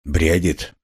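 usual speaking voice

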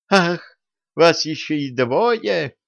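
slow indignant outburst; outraged, drawn out. A narrator's reading. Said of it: "возмутилась Василиса".

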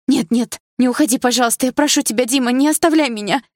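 high, loud desperate begging; cling to him and beg him not to go; frightened pleading. A narrator's reading. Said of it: "испуганно вцепилась я в Диму".

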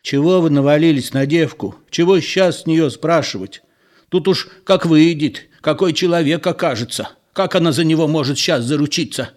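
a lightly voiced old man scolding the women; grumbling old man. A narrator's reading. Old man defends Lyuba.

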